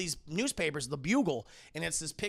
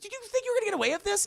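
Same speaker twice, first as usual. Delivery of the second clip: mocking accusation; 14 semitones higher, 3 dB louder, much faster than usual. [name] confronting Peter.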